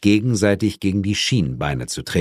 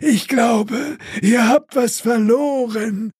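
ghost voice; slower than usual. Acted. Ghost hands over captive.

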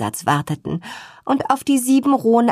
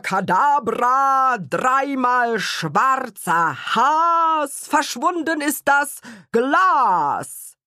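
slow, solemn, higher incantation; magic spell; 5 semitones higher, much slower than usual. Acted. Father casting a spell for the children, slow solemn incantation. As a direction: draw out each word like a stage magician. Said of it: "sprach er laut und deutlich den Zauberspruch".

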